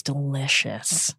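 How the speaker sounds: slow, soft deadpan sarcasm; dry, quiet mock approval; sarcastic. Real, not acted. Sarcastic comment on dragon meat.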